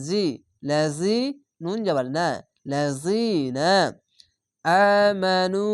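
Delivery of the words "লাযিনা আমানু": লাযিনা আমানু chanted very slowly, vowels stretched; Quranic recitation. Shown as a demonstration. Teacher recites the joined words for students, slow chant. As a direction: recite it like tilawat, slow and drawn. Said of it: "এক আলিফ টেনে পড়বেন".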